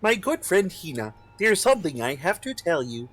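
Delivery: melodic narrator voice. Acted. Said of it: "my melodic, angelic, harmonic narrator voice".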